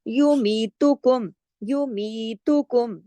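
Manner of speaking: युमीतुकुम with the mi drawn out two counts; stretched mi. Shown as a demonstration. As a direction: lengthen मी in युमीतुकुम. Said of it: "मी को दो हरकत खींचा".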